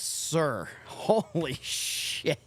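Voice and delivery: ordinary talk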